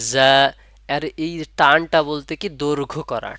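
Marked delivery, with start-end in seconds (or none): 0.1-0.3 জা with the vowel held out one beat; one-alif stretch. Shown as a demonstration. Teacher showing a one-alif stretch, drawn out. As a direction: draw out জা firmly and evenly. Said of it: "এক আলিফ পরিমাণ টান হবে".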